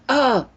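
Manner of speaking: high-pitched imitated gasp; retold startled "oh". Woman re-enacts jumping back into her body, startled oh. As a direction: act out a sudden jolt of surprise. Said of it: "I went".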